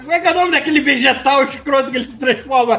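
high-pitched laughing through words. Real, not acted. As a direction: laugh while fumbling for the name. Laughing, can't recall name.

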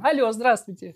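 high put-on phone voice, laughing; disguised voice. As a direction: pretend to be a stranger calling. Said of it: "с изменённым голосом".

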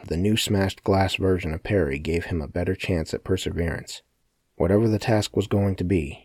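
plain reading voice

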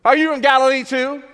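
high, loud mocking jab; insulting sneer. Angry leaders taunting Nicodemus about Galilee, loud and high. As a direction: hurl it at him as an insult. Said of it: "they attack and they insult Nicodemus".

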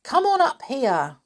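high-pitched shouted summons; shouting. A narrator's reading. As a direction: call them up from afar. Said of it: "shouted Binky".

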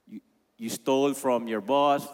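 ordinary delivery

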